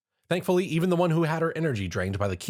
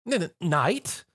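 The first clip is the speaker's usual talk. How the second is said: flustered stutter; 8 semitones higher, slower than usual. Acted.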